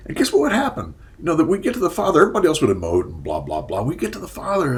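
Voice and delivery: ordinary delivery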